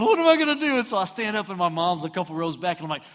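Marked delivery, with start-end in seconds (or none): 0.0-0.8 high, loud panicked cry, played for laughs; hammed-up helpless wail; mock panic. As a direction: blurt it in comic desperation. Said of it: "So I'm, like, panicking".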